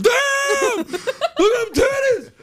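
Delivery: very high, loud hammed-up leering shout; mock a loud oaf gawking; crude dude. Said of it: "he goes".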